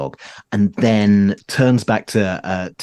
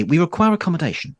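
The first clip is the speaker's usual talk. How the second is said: prim demand; 10 semitones higher, faster than usual. Acted. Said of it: "and say".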